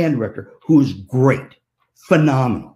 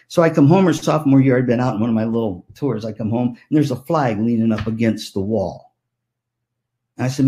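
ordinary talk

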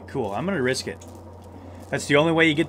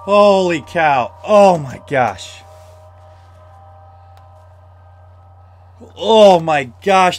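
slow, louder stunned exclaims; breathless shock; 2 semitones higher, 8 dB louder, much slower than usual. Real, not acted. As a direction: let the fright drain out slowly. Shaken after escape.

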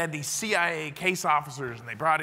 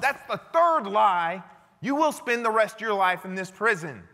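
stern verdict; 5 semitones higher, 4 dB louder than usual. Retold or imitated. Interrogator sentencing the lying prisoner.

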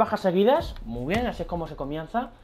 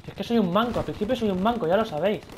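ordinary talk, nothing marked

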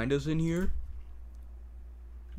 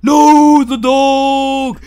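high, very loud cry of alarm; alarmed play shout; 12 semitones higher, 18 dB louder, much slower than usual. Pretend. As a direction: shout it out high and long in pretend panic.

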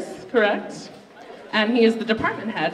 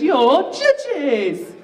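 laughing mock British TV-host call, a touch higher and louder; Cat Deeley British accent; 6 semitones higher, 4 dB louder than usual. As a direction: ham up a British host hailing the judges. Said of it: "stupid British accent because of ... Cat Deeley".

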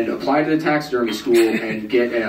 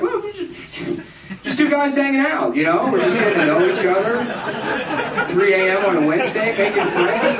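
creepy stranger; 9 semitones higher than usual. Retold or imitated. Creepy driver soothing his passenger, high sing-song. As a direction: lay on the fake friendliness.

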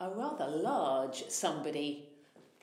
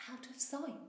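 usual speaking voice

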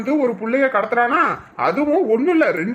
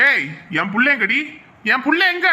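angry demand. Acted. Father demands his child.

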